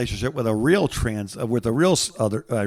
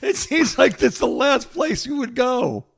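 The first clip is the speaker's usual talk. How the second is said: laughing; 10 semitones higher, slower than usual. Real, not acted. Laughing at absurd idea.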